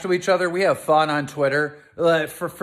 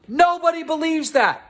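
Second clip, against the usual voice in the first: exasperated scoff; 10 semitones higher than usual. Real, not acted. Keem furious rebuttal.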